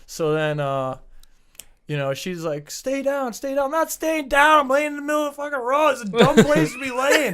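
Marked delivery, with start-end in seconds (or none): 2.7-3.7 high-pitched urging; urgent lady bystander. Retold or imitated. Lady to the injured rider, high urgent order. 3.7-7.3 high, loud rapid ranting; indignant retort. Retold or imitated. Hit rider arguing in the road, loud indignant rant. Acts angry retort to the lady.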